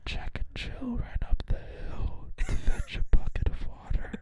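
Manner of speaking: slow eerie whisper; spooky whisper. Acted. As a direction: whisper it slowly and eerily. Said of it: "I'm gonna be like".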